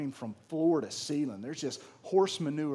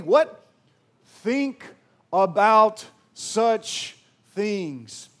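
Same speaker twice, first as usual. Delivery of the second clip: scripture preaching; 6 semitones higher, 10 dB louder than usual. Acted. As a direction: proclaim the verse with full force. Reading Philippians with preaching force.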